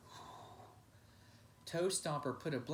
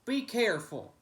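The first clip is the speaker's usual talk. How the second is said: sharp loud call, higher; shout; 8 semitones higher, 9 dB louder than usual. Acted. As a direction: shout to stop the kick. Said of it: "Toe Stomper shouted".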